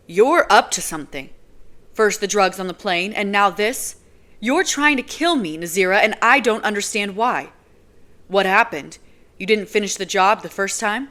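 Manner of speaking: loud, building fury; angry yelling at the woman he suspects; rising rage. Acted. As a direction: shout the accusations at her. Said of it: "rage building inside of me again".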